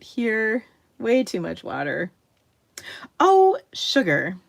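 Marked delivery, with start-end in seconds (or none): 3.2-4.4 higher, louder, slow Oh, sugar; minced curse at a spill, loud and drawn out; startled exclamation. Real, not acted. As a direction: yelp it when the water spills. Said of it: "not the S word I meant".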